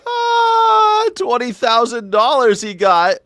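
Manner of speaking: high, loud wailing outburst; mock amazement. Host mocking a twenty thousand dollar refund, hammed-up amazement. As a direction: squeal it in exaggerated shock. Mock reaction to news clip.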